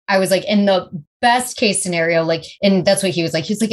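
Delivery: ordinary delivery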